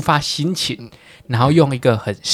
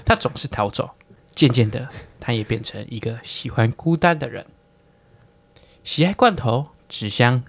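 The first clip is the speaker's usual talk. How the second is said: poem recitation; 2 semitones lower, 4 dB quieter than usual. Acted. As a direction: recite it like a poetry contest. Recites friend's poem formally.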